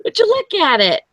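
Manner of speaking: very high comic catchphrase voice; Ed Bassmaster. Acted. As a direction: push it way up high, falling at the end. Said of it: "like, Ed Bassmaster voice".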